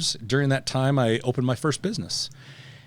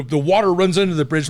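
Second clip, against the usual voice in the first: stiff, loud and higher cop reciting a code phrase; cop code talk; 6 semitones higher, 6 dB louder than usual. Retold or imitated. Bald cop mutters code words to the team, higher and louder. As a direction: play the cop speaking in secret code. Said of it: "He's like".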